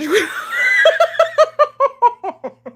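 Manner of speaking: long high laughing fit; laughing at own embarrassment; embarrassed laughter. Real, not acted. Embarrassed at what she did, long high laughter.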